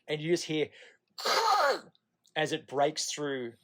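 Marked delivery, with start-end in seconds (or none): 1.2-1.8 high squeaky crack sound imitating cartilage breaking; imitated crunch, high and loud; crunching crack noise. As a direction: make the snap of the cartilage going.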